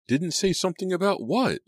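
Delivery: very high, loud question; exasperated shriek. A narrator's reading. Girl kept in suspense by friend, exasperated shriek. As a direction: shriek it, desperate to know. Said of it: "Selina shrieked".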